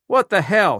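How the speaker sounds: sudden alarmed shout. A narrator's reading. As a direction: yell it in shock. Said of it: "she shouted".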